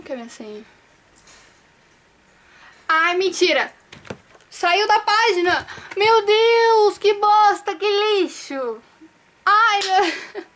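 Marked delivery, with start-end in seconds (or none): 2.9-10.4 higher and louder, exasperated cry; real exasperation at the crashed page; loud exasperated cry, slowing down at the end; frustrated outburst. Real, not acted. Page crashed while logging in.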